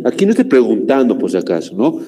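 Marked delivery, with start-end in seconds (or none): none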